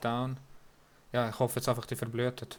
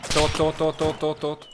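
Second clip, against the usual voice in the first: loud rapid chant of kills; excited shouting; 4 semitones higher, 9 dB louder, slower than usual. Real, not acted. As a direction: shout each one out, pumped. Shouting kills during fight.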